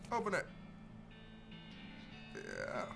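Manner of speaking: usual speaking voice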